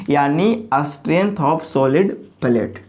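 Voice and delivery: very slow, word by word; slow dictation. Shown as a demonstration. Teacher dictating a definition to the class, very slow. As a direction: spell it out slowly for students to write down.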